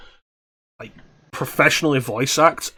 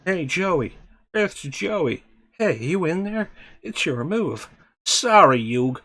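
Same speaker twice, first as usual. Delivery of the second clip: higher, Brooklyn-tinged card-game banter; Brooklyn accent; 5 semitones higher, slower than usual. Acted. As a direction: poke a friend in Brooklyn. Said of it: "Doing this Brooklyn accent".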